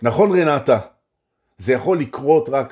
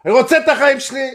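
high, loud, fast shout; shouting that you want your life; shouted affirmation; 13 semitones higher, 6 dB louder, faster than usual. Shown as a demonstration. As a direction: yell it out to the room. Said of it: "תצעקו את זה".

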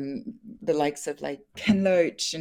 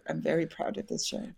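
softer heartfelt repeat, stress on very; sincere; 6 dB quieter than usual. Real, not acted. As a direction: say it again softly, meaning it. Repeats pride in the show.

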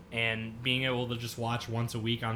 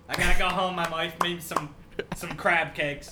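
put-on Boston accent; 5 semitones higher than usual. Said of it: "Nick do his Boston guy".

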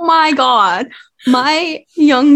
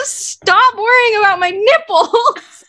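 laughing; 8 semitones higher than usual. Real, not acted. Laughing at daughter's question.

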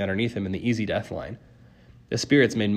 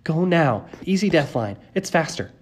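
urgent order; 6 semitones higher than usual. A narrator's reading. Ordering friends past the monster.

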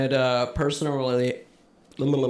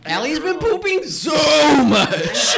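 high laughing shout; 11 semitones higher, 6 dB louder, slower than usual. Real, not acted. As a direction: yell it through laughter. Teasing about diarrhea.

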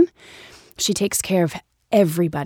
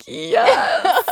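thrilled yes; 12 semitones higher than usual. Retold or imitated. Instantly accepting a role offer.

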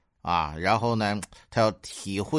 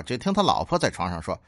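ordinary talk, nothing marked

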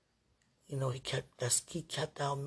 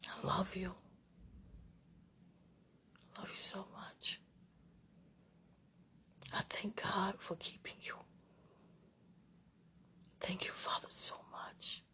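soft breathy whisper; 3 semitones higher, 12 dB quieter than usual. Real, not acted. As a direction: whisper it tenderly.

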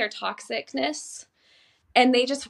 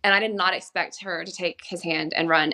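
usual speaking voice

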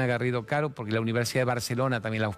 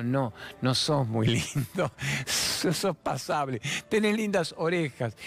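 teasing mother; 2 semitones higher, slower than usual. Retold or imitated. Mother's teasing reply, acted.

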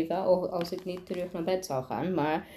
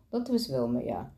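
low, offhand confirmation; studied nonchalance; 7 semitones lower, 3 dB quieter than usual. A narrator's reading. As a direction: drop it casually, as if it were nothing. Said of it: "zei hij nonchalant mogelijk".

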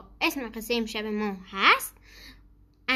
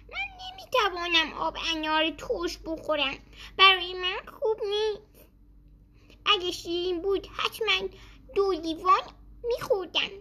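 put-on old man, a touch plaintive; 6 semitones higher than usual. A narrator's reading. Old man declines sour juice.

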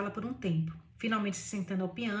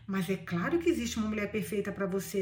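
ordinary delivery, nothing marked

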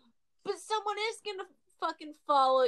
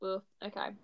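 normal talking voice

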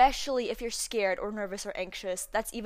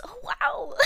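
imitating a stranger's awed wow, pitched far higher; a high, marvelling oh wow with a laugh in it; delighted mock amazement, way up high; amazed oh wow. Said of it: "in that exact tone".

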